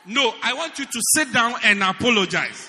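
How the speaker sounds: wife's loud insistent demand; mock demanding wife. Preacher voicing the wife, loud and demanding.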